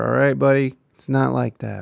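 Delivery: usual speaking voice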